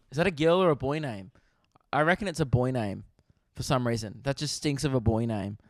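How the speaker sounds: usual speaking voice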